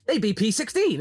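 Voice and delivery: ordinary talk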